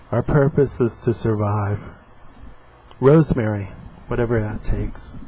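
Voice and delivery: comic panels read aloud with voices; acting out a comic strip; dramatic reading.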